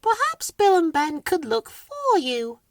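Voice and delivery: very high, gentle little voice; high fluting helpful voice; kindly Weed. A narrator's reading. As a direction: offer it sweetly, high up. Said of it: "said Weed kindly".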